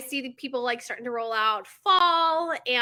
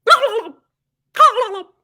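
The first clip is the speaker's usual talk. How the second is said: high, loud gobbling burst; turkey gobble; 11 semitones higher, 11 dB louder, faster than usual. Retold or imitated. Excited kid after her odd saying, imitated turkey gobble. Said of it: "and then proceeded to go".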